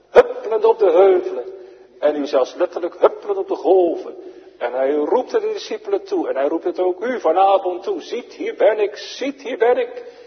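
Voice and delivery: high, loud, ringing pulpit voice; fervent preaching. Acted. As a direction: proclaim it at full force from the pulpit. Preacher in full fervour.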